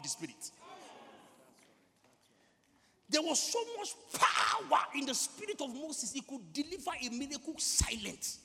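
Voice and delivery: loud, high, emphatic sermon delivery; full-force preaching. Real, not acted. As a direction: punch the key words with rising fervour.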